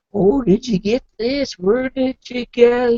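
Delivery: high, slowed fussing questions; put-on concern, pitched high; acting all concerned. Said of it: "he's acting all concerned".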